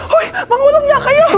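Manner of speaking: very high, loud mock-outraged yell; outraged screaming. Teacher being stuck to the wall by students, outraged screaming. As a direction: shriek at the culprits, hammed up.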